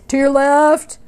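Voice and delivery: curt cyclist. Retold or imitated. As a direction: bark it like a passing cyclist. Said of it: "what I get is".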